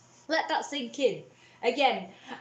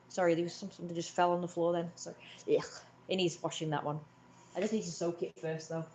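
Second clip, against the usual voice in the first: normal talking voice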